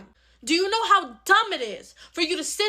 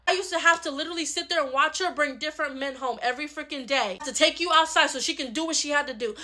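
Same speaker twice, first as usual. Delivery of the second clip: normal talking voice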